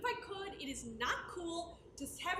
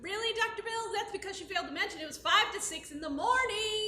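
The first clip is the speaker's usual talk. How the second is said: put-on exasperated teen, louder and higher; indignant protest; 5 semitones higher, 7 dB louder than usual. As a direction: call out the omission.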